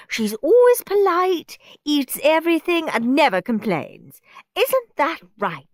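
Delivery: high, loud gushing mum; gushing mother. A narrator's reading. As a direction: gush proudly about precious. Mother gushing over her daughter.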